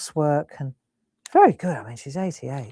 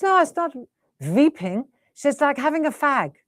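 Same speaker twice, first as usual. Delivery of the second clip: high imitation of German mum; mum's German accent, pitched high; German mum putting it right, voice up high; 9 semitones higher than usual. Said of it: "and she's German, isn't she".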